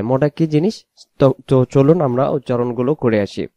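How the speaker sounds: ordinary talk, nothing marked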